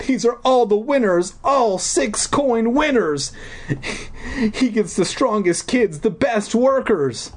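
put-on high, slowish fearful girl; frightened girl. Deirdre explaining the king's slaves, high and fearful. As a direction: explain it as a scared captive.

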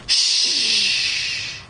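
unvoiced hush; shushing. A narrator's reading. Hushing the boys.

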